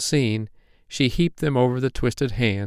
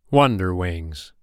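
ordinary delivery

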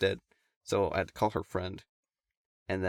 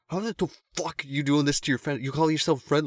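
high, raised angry rant, imitated; ballistic telling-off; 7 semitones higher, 3 dB louder than usual. Guy helping search tears into the friends, mock-ballistic. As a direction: act out someone going off on them. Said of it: "totally goes off on them, like ballistic".

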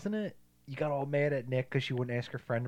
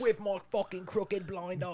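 mock British accent; 8 semitones higher, slower than usual. Joking British voices about the film.

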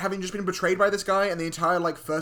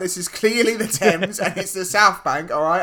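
loud, higher-pitched insistence; exasperated, emphatic correction; mock indignation; 3 semitones higher, 8 dB louder than usual. Real, not acted. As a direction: protest loudly, punching out the correction. Mock-indignant correction of co-host about London.

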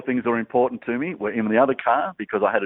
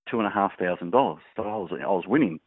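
normal talking voice

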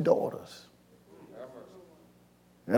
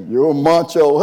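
ordinary delivery

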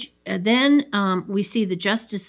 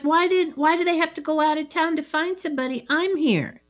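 higher, fast resentful whine; indignant complaint; 8 semitones higher, faster than usual. Retold or imitated. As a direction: rush it out, aggrieved and high. Voicing Harv's resentment.

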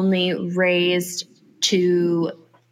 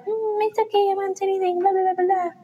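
high, light singsong mockery; mocking feigned innocence; 10 semitones higher, much faster than usual. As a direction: brush it off in a high, dismissive little voice. Mocking what she would say.

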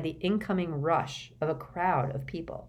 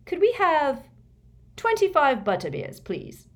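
put-on loud, high cheeky teen voice; cheerful cocky order; Fred voice; 11 semitones higher, 6 dB louder than usual. A narrator's reading. Fred's voice in audiobook.

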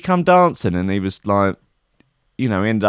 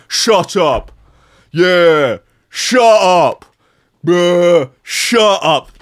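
lightly imitating a grime rapper, a little louder; mock rapper; 3 semitones higher, 4 dB louder, much slower than usual. Mocking a [name] song to the listeners, in a slightly louder mock rapper voice. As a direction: repeat the hook like a bored rapper. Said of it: "he just goes like".

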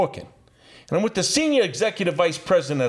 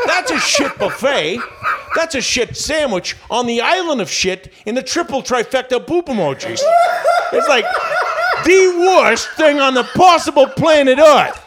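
loud, high, laughing mock rant, building to a yell; cracking up while ranting at full volume about a terrible deal; laughing shout; 9 semitones higher, 7 dB louder than usual. Telling the boss a lost deal was worse than he said, a laughing shout.

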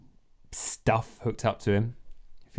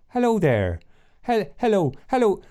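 high, loud mock-Irish greeting; attempted Irish accent; 11 semitones higher, 4 dB louder than usual. Game master practicing for the robot doctor, high-pitched Irish accent. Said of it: "uh, Irish, Irish".

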